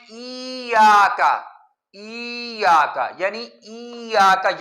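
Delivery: ایاک with the vowel stretched extra long; drawn-out iyyaka. Shown as a demonstration. Teacher shows the lengthened mistake, slowly drawn. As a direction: drag out ایاک as iyyaaaka. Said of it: "اس کو لمبا پڑھتے ہیں".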